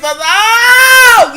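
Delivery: high wailing cry of oh; mock wailing oh. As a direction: wail it like grief overtook you. Crying at Oscars memorial.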